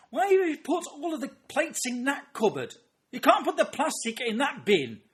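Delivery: very high, shouted imitation of scolding; escalating screech of domestic fury; nagging wife. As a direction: berate a husband like an exasperated wife. Wife berating husband, acted.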